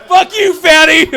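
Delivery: high, drawn-out jeer; mock angry. Viewer jeering at people on a weight show, mock-angry high taunt. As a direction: stretch the insult out high and slow.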